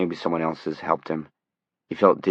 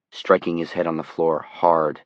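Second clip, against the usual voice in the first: plain reading voice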